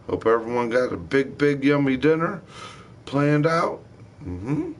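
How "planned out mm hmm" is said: usual speaking voice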